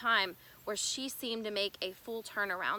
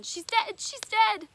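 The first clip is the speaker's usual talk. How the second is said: screamed again, much higher and louder; hysterical repeating; 12 semitones higher, 9 dB louder, slower than usual. Retold or imitated. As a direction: wail it over and over like a mother in shock. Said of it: "She just kept repeating over and over again".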